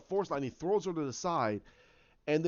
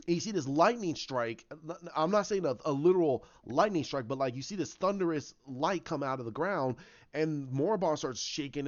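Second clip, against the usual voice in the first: ordinary delivery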